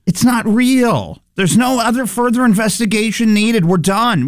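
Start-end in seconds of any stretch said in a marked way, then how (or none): 0.0-1.2 slow, emphatic disbelief; drawn-out exasperated insistence. Real, not acted. Exasperated at the news.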